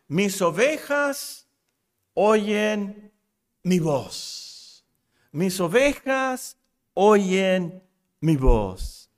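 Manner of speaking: very slow, spaced-out verse reading; solemn scripture reading. Real, not acted. Preacher reads Christ's words to the congregation, solemn scripture reading. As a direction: let each phrase of the verse stand alone.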